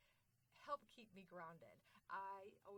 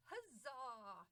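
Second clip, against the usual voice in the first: loud, higher rallying cry; triumphant cheer; 4 semitones higher, 6 dB louder than usual. Pretend. Speaker ending the mantra for the audience, triumphant cheer. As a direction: cap it with a hearty cheer.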